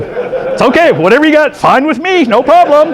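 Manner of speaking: high, cheery, laughing mock coach; hammed-up happy coach; smiling coach. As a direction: play the grinning coach who got lucky. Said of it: "Bowen's got this big old smile going".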